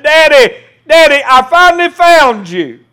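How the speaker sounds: put-on a lost child cheerfully crying out to his dad; little boy calling out. Lost boy under the leaves calling to his father, excited child's voice. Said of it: "came crawling out from under those leaves and said".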